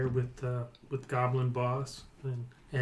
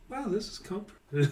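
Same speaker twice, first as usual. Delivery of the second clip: higher, soft contented goblin; sleepy goblin lieutenant; 8 semitones higher, 4 dB quieter, faster than usual. Acted. Lieutenant falling asleep on the floor, high and content. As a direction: sigh it as you doze off. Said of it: "it looks like".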